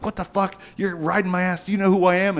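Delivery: high mock-outraged old star; angry Chevy Chase. Chevy Chase snapping at a tailgating kid, mock-indignant and high. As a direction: huff like a furious entitled celebrity. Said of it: "Chevy Chase is like".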